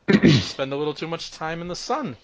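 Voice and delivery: high, loud character voice that misses; off-target constable voice. Acted. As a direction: push the constable's voice up too high. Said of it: "That's not his voice".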